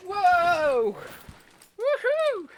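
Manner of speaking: loud, high whoop of delight; thrilled whoop. Real, not acted. Jumping on camera, loud thrilled whoop. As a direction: whoop as you land the jump.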